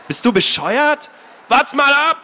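high, loud threatening yell; angry shout. Acted. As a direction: threaten her across the fire. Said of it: "rief er".

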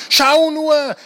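high-pitched, excited call; enthusiastic call. A narrator's reading. Ellie calls her brother to the plane window, enthusiastic. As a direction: call out with delight. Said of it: "rief Ellie begeistert".

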